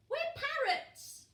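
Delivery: squeaky, slow cheeky fib; high silly chick voices. Acted. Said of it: "said Peaky and Poots".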